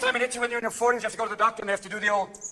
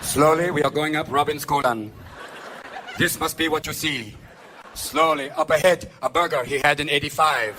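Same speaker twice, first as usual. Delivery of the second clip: documentary narrator; 9 semitones lower than usual. Acted. Narrating a trip up Robin's colon, documentary style. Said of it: "your own Discovery Channel special".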